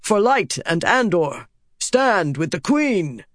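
restrained battle cry, stretched at the end; narrated yell. A narrator's reading. Soldiers take up the cry for their queen, mild yell. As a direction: call it out like men rallying, without full volume. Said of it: "they yelled".

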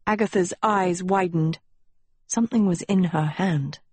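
ordinary delivery, nothing marked

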